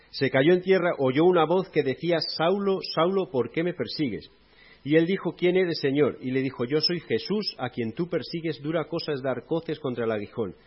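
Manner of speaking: loud, impassioned Bible narration; dramatic scripture reading. Acted. Preacher reads Saul meeting Jesus to the church, loud and impassioned. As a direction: declaim the Damascus road scene.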